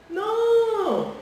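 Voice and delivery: long, high, loud no; hammed-up objection, loud and drawn out; emphatic rejection. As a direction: stretch out a high-pitched protest. Rejects the wrong example.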